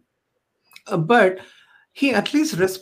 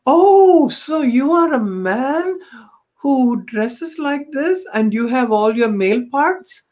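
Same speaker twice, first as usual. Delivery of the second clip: high, slow, drawn-out disbelief; mock-incredulous, higher-pitched retold question; astonished questioning; 6 semitones higher, slower than usual. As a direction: imitate a lady reacting with a long, high "oh". Retelling a lady's astonished question.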